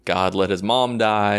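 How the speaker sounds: ordinary talk, nothing marked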